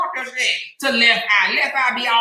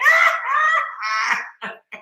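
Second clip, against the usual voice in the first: shrieking laugh. Real, not acted. Host howling with laughter after the quote. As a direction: let the laugh rip.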